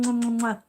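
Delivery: loud kissing sounds; blown kisses. Real, not acted. Saying goodbye to Gigi, loud blown kisses. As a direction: blow three loud kisses. Blows kisses to leaving viewer.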